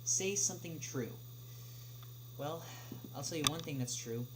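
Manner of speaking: usual speaking voice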